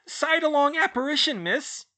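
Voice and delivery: very high, loud squeaking elf voice; squeaky elf. A narrator's reading. House elf squeaking to Hermione from her shoulders, high and loud.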